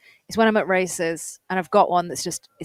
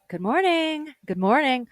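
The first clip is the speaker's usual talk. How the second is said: American greeting; 5 semitones higher, slower than usual. Retold or imitated. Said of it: "come out like".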